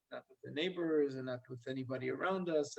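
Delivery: ordinary talk, nothing marked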